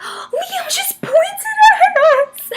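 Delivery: very high, slow, near-tearful squeal; overwhelmed squeal. Retold or imitated. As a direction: stretch it out, almost crying with joy. Overwhelmed after Liam points.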